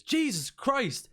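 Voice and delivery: high, drawn-out shocked outburst; shocked exclamation. Acted. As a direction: cry out in appalled surprise. Shopkeeper reacts to filthy customer.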